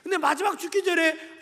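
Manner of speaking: impassioned retelling from the pulpit; fervent preaching. Real, not acted. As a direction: preach it forcefully, surging on key words. Preaches at full force.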